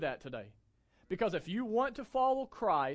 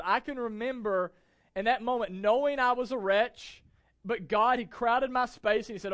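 loud, rising preacher's build; fervent sermon delivery; full-force preaching; 6 semitones higher, 8 dB louder than usual. Real, not acted. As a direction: preach it out to the whole room.

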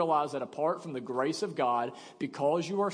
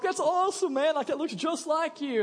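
mocking onlookers; 10 semitones higher than usual. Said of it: "everybody else was like".

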